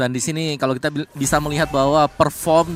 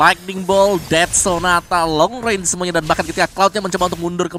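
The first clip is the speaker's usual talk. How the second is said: excited; 4 semitones higher, 5 dB louder than usual. Real, not acted. Caster calls skills during fight.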